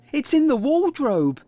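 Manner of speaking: put-on high, urgent child's voice; feigned alarm. A narrator's reading. Kipper tricking Mum about the wardrobe, in feigned alarm. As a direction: sound scared, pitched right up. Kipper faking fear of monster.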